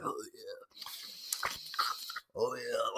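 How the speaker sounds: breathy, hushed mock moan of a creepy guard; mock leering guard.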